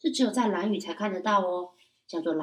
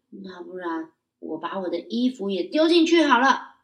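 usual speaking voice

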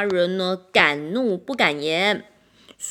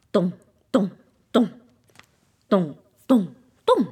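drum beat sound; 5 dB louder than usual. A narrator's reading. Drum beats imitated.

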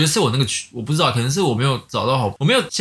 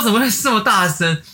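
mock disbelief; 8 semitones higher than usual. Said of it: "我说".

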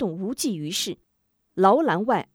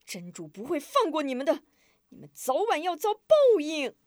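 furious roar; 7 semitones higher than usual. Acted. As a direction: roar curses through the bars. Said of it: "愤怒地摇着铁栏大吼".

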